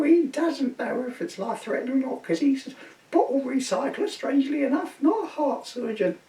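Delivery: a high, busy housewife voice; a wife phoning about her husband's chest pains, high and flustered; flustered wife on phone. Retold or imitated.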